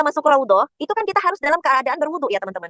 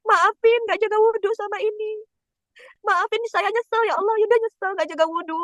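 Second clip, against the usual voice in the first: tearful prayer; 7 semitones higher than usual. Retold or imitated. Retelling her own prayer to God in tears at Raudhah, high and tearful. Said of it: "Nangis itu Yunda".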